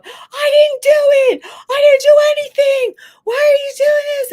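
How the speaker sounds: high, loud imitation of a screaming boy; screaming kid. Boy to the principal in his office, imitated screaming. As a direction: shriek like a kid protesting his innocence. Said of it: "he's screaming".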